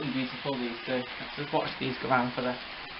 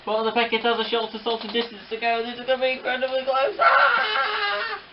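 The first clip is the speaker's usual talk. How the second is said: high, loud, building race call; racehorse commentator; 7 semitones higher, 4 dB louder than usual. Pretend. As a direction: call the finish like the races. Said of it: "talk like a racehorse commentator".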